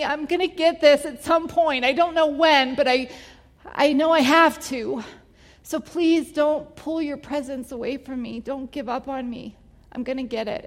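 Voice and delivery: pleading and on the verge of crying; tearful pleading prayer. Retold or imitated. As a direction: beg through tears, voice pushed up high. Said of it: "And so I'm crying and I'm asking Holy Spirit".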